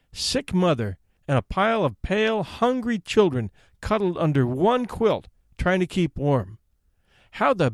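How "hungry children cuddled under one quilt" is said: usual speaking voice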